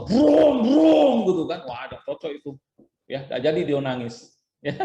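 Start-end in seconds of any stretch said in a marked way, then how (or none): 0.1-1.2 high, loud "brong" engine roar; loud motorbike revving imitation. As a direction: imitate a motorbike roaring past. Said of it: "Datang orang bawa motor". (tiruan raungan motor yang keras)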